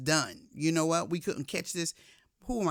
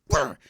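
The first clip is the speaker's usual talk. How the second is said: angry brr; 12 dB louder than usual. Retold or imitated. Angry reaction to sister.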